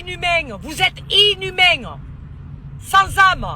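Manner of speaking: slow, drawn-out accusation, pitched high; real indignant reproach; indignant condemnation. Real, not acted. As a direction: stretch each word in outrage. Denouncing killers on a live.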